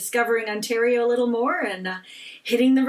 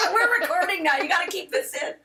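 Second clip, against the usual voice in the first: laughing; 7 semitones higher than usual. Real, not acted. Giggling at their own flub.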